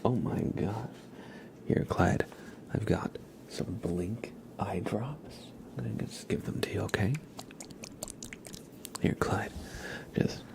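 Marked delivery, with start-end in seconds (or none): 1.7-10.4 soft whispered pretend care; ASMR whisper. Real, not acted. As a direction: whisper while handing over imaginary eye drops. ASMR whisper, giving pretend eye drops.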